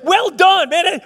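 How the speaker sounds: "Well done" called out high and loud with delight; Jesus praising. Pretend. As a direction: shout your pride in him. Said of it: "do you wanna hear Jesus say".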